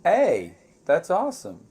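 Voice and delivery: ordinary talk, nothing marked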